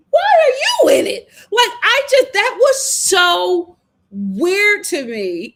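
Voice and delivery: loud, high-pitched shriek of disbelief; incredulous outburst. Real, not acted. Host reacting to Kelly butting in, incredulous outburst. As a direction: cry out in total bafflement.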